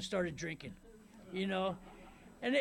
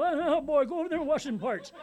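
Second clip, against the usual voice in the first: higher, louder, laughing wino voice; hammed-up impression of a tipsy old mechanic; shaky drunk Shaky Bob; 5 semitones higher, 6 dB louder than usual. Mechanic giving a kid an order, in a shaky drunken voice. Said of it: "That's how he talked".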